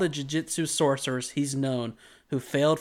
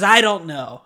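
mock curt customer; 4 semitones higher, 8 dB louder than usual. Retold customer again, loud.

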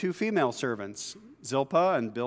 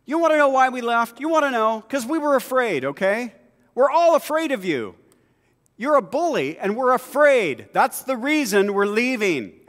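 higher, louder, fed-up confrontation; Jacob's angry outburst; 8 semitones higher, 6 dB louder than usual. Acted. Jacob confronting bullying Laban, loud fed-up outburst. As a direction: finally let it all out at him. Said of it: "Jacob answered and said to Laban".

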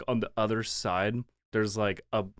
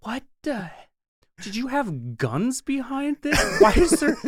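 high, loud, disbelieving; shocked; 12 semitones higher, 6 dB louder than usual. Retold or imitated. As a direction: react like you can't believe it. Retelling shock at the door.

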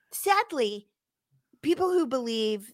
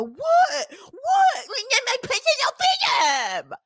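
outraged mock shout; 13 semitones higher than usual. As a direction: shriek like an entitled protester. Mocking entitled protester.

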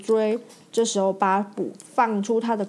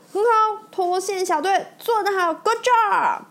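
cheering praise; 9 semitones higher than usual. Acted. McQueen cheering the rescue team, excited. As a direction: shout well done to the team. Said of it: "麦昆大喊".

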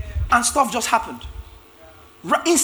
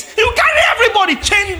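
laughing mock; 12 semitones higher, 5 dB louder than usual. Mocking Abraham's zeal, laughing.

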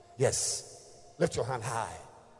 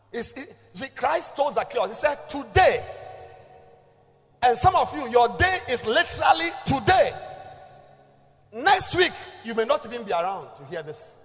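high, loud fiery preaching; preaching at full force; 12 semitones higher, 15 dB louder than usual. Acted. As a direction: shout it to the crowd.

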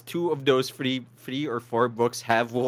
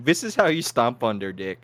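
normal talking voice

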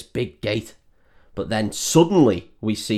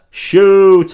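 loud, high "shooter" with a falling end; quoting the scary hunter's famous line, loud and high; quoted film line; 8 semitones higher, 11 dB louder than usual. Retold or imitated. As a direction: bark out "shooter" like the terrifying film moment. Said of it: "most quoted lines".

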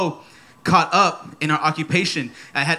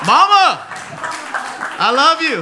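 calling out to mama; 10 semitones higher, 5 dB louder than usual. Real, not acted. Preacher calling out to his mother.

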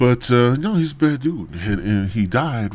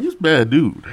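usual speaking voice

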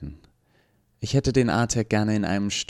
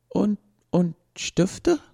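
much higher, slow, stammering robot voice; a machine hardly daring to hope; robot asks the professor about pens, breathless with hope; eager robot; 13 semitones higher, 3 dB louder, much slower than usual. Acted.

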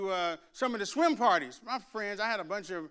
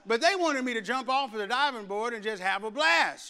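usual speaking voice

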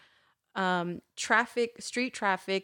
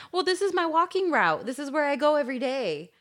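high, louder, indignant justification; mock nosy neighbor; 7 semitones higher, 4 dB louder than usual. Neighbor defending the walking route, mock indignant. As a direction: imitate a put-out local, pitched up.

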